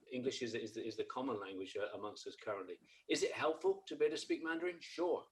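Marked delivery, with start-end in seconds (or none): none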